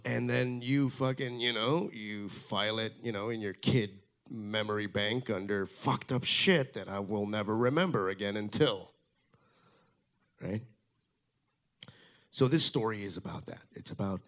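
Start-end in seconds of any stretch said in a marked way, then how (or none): none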